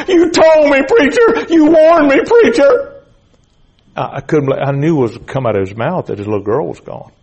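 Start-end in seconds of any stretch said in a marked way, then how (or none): 0.1-3.0 very high, loud cries of grief; full-voiced imitated wail of regret; anguished wailing. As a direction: scream it in despair at the preacher. Grieving father wails to the preacher.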